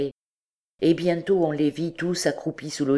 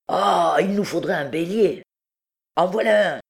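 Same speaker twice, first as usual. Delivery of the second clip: higher, louder, emphatic character voice; old truand; 4 semitones higher, 4 dB louder than usual. A narrator's reading. Old hutin suggesting a ram to his chief, emphatic. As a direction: offer the answer with an eager exclamation.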